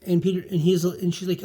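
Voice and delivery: ordinary talk, nothing marked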